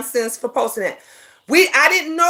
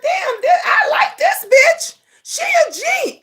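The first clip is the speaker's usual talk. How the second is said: excited shout; 13 semitones higher than usual. Retold or imitated. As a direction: shout it out in delighted disbelief. Excited reaction retold.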